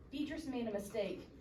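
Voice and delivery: ordinary delivery, nothing marked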